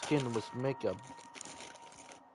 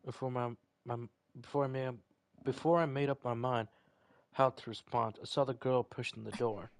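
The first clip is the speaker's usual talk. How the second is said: normal talking voice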